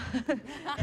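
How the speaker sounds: high bursting laugh of delight; giddy high laugh. Real, not acted. Actress laughing with joy at Cate's praise. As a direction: laugh out in thrilled disbelief.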